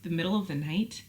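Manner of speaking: normal talking voice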